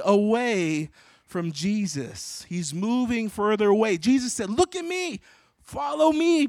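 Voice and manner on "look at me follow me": much higher, insistent call; urgent calling out. Acted. Jesus to Peter looking away, urgent high calling out. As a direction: call him back as he drifts away.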